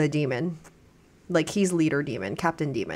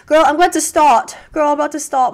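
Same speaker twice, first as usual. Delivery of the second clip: higher, louder excited chatter; 8 semitones higher, 7 dB louder, faster than usual. Real, not acted. Sister hears her say she's about to start, excited. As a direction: bubble over, nearly shouting.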